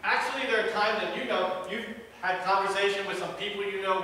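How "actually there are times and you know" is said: ordinary talk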